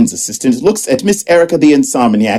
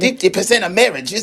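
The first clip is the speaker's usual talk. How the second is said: higher, softer sassy imaginary friend; Patty voice; 5 semitones higher, 4 dB quieter than usual. Acted. Imaginary Patty scolding Evan on the stoop, higher and softer. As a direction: scold him like a sassy invisible sidekick. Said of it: "Quiet, Patty".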